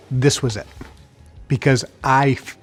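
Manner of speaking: ordinary talk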